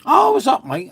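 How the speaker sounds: sudden high, loud burst of protest; excited exclaim. Real, not acted. Streamer protesting to chat that he was up, excitedly. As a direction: jump up in pitch to insist.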